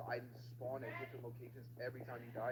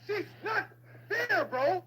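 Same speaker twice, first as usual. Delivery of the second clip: shrieking much higher and louder; panicked screaming; 10 dB louder, slower than usual. Real, not acted. Gamer chased by the monster, screaming in panic.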